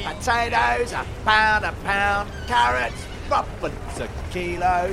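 market trader call. Acted. Vendor calling prices.

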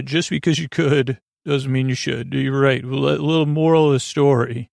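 usual speaking voice

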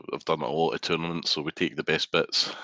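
ordinary talk, nothing marked

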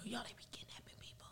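hushed conspiratorial whisper; breathy gossip whisper. Real, not acted. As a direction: lean in and whisper the secret. Secretive gossip opener.

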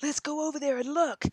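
put-on high, eager kid urging the others; a curious kid daring the others to go look; child whispering a dare to explore; excited kid voice. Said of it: "And then they're like".

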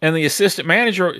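ordinary talk

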